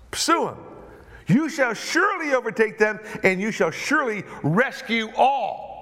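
God rousing David to chase the raiders, loud and high; booming divine command. Acted. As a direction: proclaim it like God urging him on. God's command voiced in sermon.

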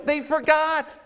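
punched high and loud; They forgot. hit hard and high; emphatic declaration. Acted. Repeated line, first loud.

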